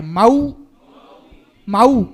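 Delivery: मऊ loud, औ broken into म-ऊ; split au sound. Shown as a demonstration. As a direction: pout the lips on ऊ in मऊ. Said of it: "औ की मात्रा है". (मऊ ज़ोर से, होंठ गोल करके)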